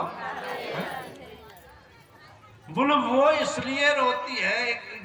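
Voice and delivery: slow, high, stretched preacher call; drawn-out call. Acted. Preacher teasing crowd, slow high drawn-out call.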